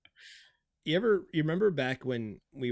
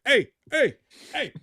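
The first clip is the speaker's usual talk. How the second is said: high, loud mock holler; old porch guy; 13 semitones higher, 10 dB louder than usual. Old guy telling you to leave, imitated holler. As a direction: imitate an old man yelling off trespassers. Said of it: "the old guy on ... his front porch telling you to leave".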